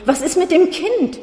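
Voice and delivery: high, loud frightened stammer. A narrator's reading. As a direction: blurt it out high in panic. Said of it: "hat er gestammelt". (hoch und laut, panisch gestammelt)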